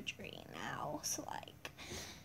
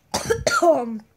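loud cough; 15 dB louder than usual. Acted. Doctor clears throat.

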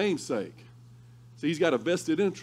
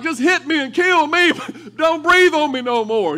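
loud, high pleading cry; desperate plea; 13 semitones higher, 11 dB louder than usual. Retold or imitated. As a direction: beg him to just end it. Begging pinned coach.

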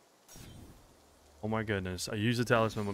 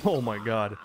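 ordinary talk

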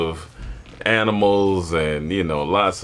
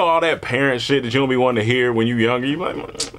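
ordinary talk

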